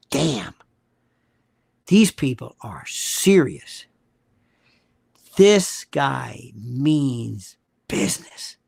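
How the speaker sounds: very low, slow and deliberate; awed realization. Retold or imitated. Host voicing people America has actually scared, low and awed. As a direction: drop deep and space the words out, imitating awed onlookers.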